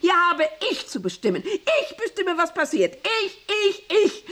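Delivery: high, emphatic outburst; overwrought, high-pitched ranting; furious assertion of authority. Acted. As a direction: hammer out who is in charge. Boss asserts control.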